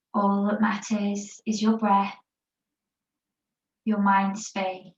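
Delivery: calm, unhurried yoga teacher voice guiding a rest pose; guided meditation. Acted. Yoga teacher guiding the class in child's pose, guided meditation voice. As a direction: ease the class into stillness, word by word.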